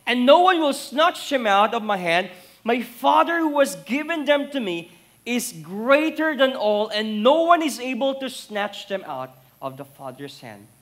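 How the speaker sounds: loud, high sermon proclamation; preacher thundering the promise; full-force preaching. Acted. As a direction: proclaim it over the whole room.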